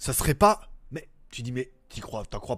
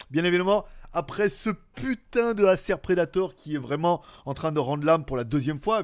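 ordinary delivery

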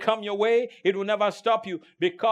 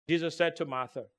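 ordinary talk, nothing marked

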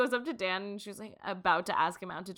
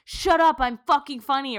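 higher, louder angry comedian yell; 7 semitones higher, 10 dB louder than usual. Retold or imitated. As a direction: snap at the crowd. Bad comedian yelling at audience.

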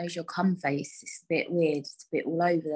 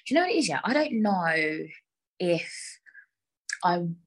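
normal talking voice

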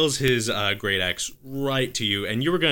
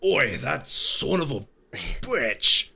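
Boston orc cursing; 5 semitones higher, slower than usual. Acted. Orc swears at the fleeing half orc, Boston accent. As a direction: spit it out as the angry Boston orc. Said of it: "he should be from Boston".

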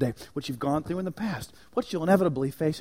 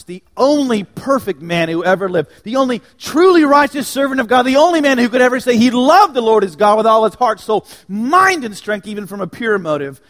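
higher, louder, rising sermon build; preaching at full force; 7 semitones higher, 13 dB louder than usual. Real, not acted.

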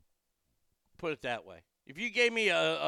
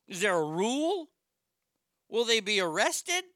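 incredulous; 6 semitones higher, 5 dB louder than usual. Real, not acted. Incredulous rant about movie.

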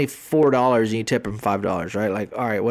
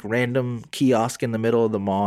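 ordinary delivery

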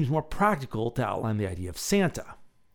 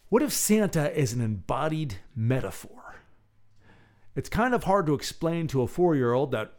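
ordinary delivery

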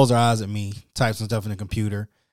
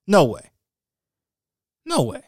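second "No way" jumps much higher; emphatic refusal; 8 semitones higher, 4 dB louder than usual. Real, not acted. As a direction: refuse flatly, then go up high in disbelief. Refusing to wait again.

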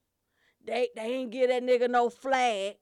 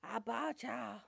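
mock guest; 3 semitones lower, 12 dB quieter, slower than usual. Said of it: "they'll come in there talking something".

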